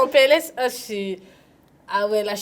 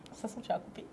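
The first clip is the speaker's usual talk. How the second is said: whispering; 18 dB quieter, much faster than usual. Real, not acted. Aside to host.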